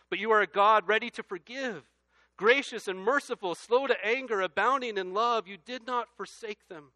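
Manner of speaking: loud, high scripture reading; preaching at full force. Acted. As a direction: proclaim the verse to the back row.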